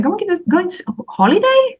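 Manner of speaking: mother guessing at the Switzerland trip, high and unsure; mom, puzzled. Retold or imitated. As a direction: guess at what she means, pitched high. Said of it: "And I said".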